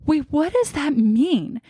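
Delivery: high, slow, bewildered question; retold stunned confusion; alarmed confusion. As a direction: draw it out high, struggling to understand. Confused reaction to test result, retold.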